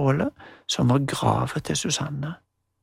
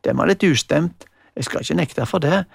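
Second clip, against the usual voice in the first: usual speaking voice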